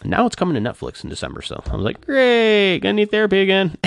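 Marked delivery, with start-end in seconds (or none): 2.0-3.8 mock despair. Fan on the film coming to Netflix, mock despair. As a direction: whine it loftily high, joking at your own expense. Acted mock dread of rewatching.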